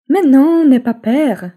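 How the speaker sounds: usual speaking voice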